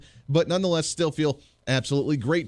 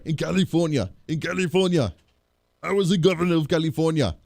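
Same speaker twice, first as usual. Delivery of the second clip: Schwarzenegger impression; 2 semitones higher than usual. Host imitating the former California governor, Austrian accent. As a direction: talk like Arnold with a heavy Austrian accent.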